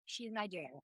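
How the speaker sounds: soft, falling deadpan reveal; quiet deadpan. Real, not acted. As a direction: drop the voice for the punchline.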